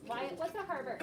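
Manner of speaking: ordinary delivery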